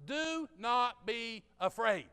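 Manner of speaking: drawn out, slow and firm; 缓慢而坚定地说“Do not be afraid”; slow angel command. Retold or imitated. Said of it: "But the angel said to him".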